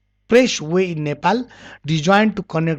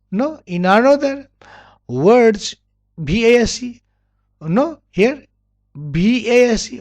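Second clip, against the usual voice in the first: normal talking voice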